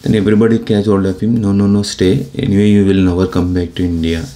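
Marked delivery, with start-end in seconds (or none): none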